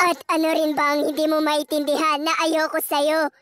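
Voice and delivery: cold fiancé. Acted. [name] calling off the wedding, low and cold. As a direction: reject her flatly. Groom rejects her.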